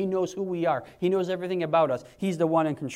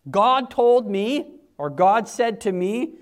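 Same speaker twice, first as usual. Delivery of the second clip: slow, loud, drawn-out quote; mock pious claim; 3 semitones higher, 6 dB louder, much slower than usual. Preacher mocking those who claim God spoke, slow and loud. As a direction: stretch it out like a self-important claim.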